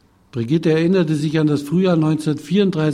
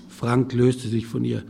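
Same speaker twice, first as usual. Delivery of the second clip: ordinary delivery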